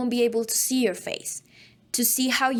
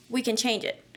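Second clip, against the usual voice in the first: ordinary delivery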